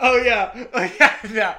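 loud laughing yes; real laughing recognition. Real, not acted.

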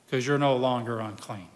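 normal talking voice

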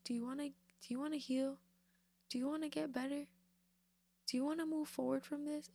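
a hint of Jesus pleading with you; coaxing Jesus. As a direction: coax them toward healing. Preacher voices Jesus gently asking.

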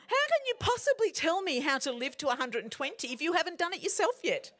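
high-pitched, incredulous mock challenge. Mock challenge to longevity guru.

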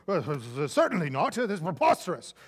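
hammed-up haughty Englishman in English accent; posh English gentleman. Pastor mocking the Englishman's disgust, in a hammed-up English accent. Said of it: "the Englishman said".

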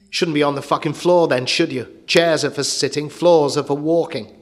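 put-on loud, brutal shout at the kid; stepfather's furious yell. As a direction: bellow it like an abusive stepfather. Abusive stepfather yelling at boy.

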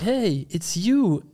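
high, drawn-out delighted hello; happy surprised greeting. Acted. Man running up to the girl by chance, high surprised greeting.

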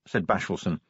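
ordinary delivery

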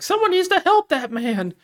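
put-on high-pitched old woman's cry; old lady. Old lady pleading for the hostage, high mock cry.

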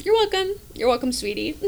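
playful giggle at fiance; laughing tease. Real, not acted. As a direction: tease him sweetly through a laugh. Teasing her fiance.

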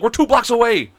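high, urgent retold protest; exasperated retort. Friend arguing with him in the car, exasperated. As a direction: throw it back as an exasperated friend.

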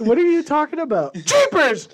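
mom's voice. Retold or imitated. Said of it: "She's like".

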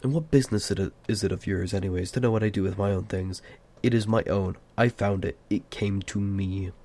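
ordinary delivery, nothing marked

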